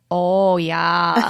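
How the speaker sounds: put-on loud, drawn-out Minnesota accent. Mom imagines the alternative to a Southern accent, mock Minnesota accent. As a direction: put on a stretched Minnesota "oh, yeah". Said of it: "like the Minnesota sort of thing".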